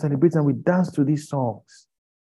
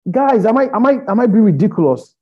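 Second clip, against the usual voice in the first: higher, louder plea to the crowd; exasperated appeal; 6 semitones higher, 6 dB louder than usual. Real, not acted. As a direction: cry out in disbelief at the audience. Heated appeal to the audience.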